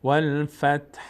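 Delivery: والفتح with a faint breath of h at the end, no vowel; والفتح ending on a breathy h, not a vowel; pausal form. Shown as a demonstration. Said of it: "بدون اینکه حرکت بدیم به ت یا ه".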